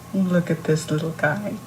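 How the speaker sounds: ordinary delivery, nothing marked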